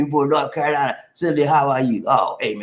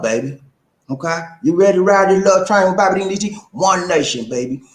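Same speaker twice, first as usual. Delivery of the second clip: ordinary talk, nothing marked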